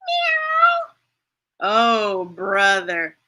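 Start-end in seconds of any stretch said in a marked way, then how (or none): 0.0-0.9 very high cat meow; high, squeaky meow. Acted. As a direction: mew like a little cat. Cat meows.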